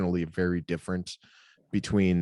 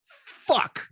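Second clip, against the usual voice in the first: sharp, high, loud curse; frustrated outburst; 4 dB louder than usual. Retold or imitated. Dad swearing at the dropped pacifier, loud frustrated yell. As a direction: blurt it out in frustration. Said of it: "I just completely ... went to ... frustration".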